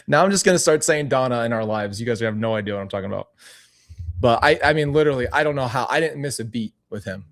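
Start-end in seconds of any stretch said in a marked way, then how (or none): none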